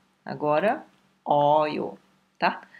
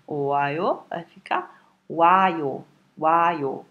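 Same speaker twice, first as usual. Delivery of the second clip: o ayo vai ficar wayo, bem devagar; slow demo; much slower than usual. Shown as a demonstration. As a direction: explica cada sílaba com calma. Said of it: "é mais fácil falar wayo". (fala pausada de professor)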